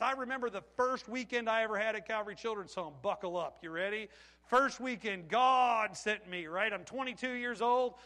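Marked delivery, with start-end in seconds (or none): none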